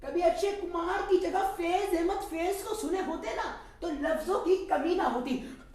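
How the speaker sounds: high, lilting female voice; young woman's voice. Acted. Sakina chides the tea seller about poetry, high teasing voice. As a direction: mimic the girl's teasing put-down. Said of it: "और बोल, बोली".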